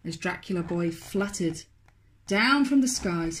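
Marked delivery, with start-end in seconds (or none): none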